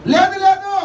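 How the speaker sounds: high, loud emphatic no. Acted. Pastor tells the despairing believer no, loud and emphatic. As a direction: cut the despair off hard.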